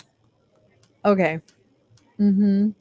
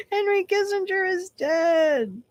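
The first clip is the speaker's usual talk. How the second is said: giddy laugh; 12 semitones higher, 3 dB quieter, slower than usual. Real, not acted. Giddy aside while laughing.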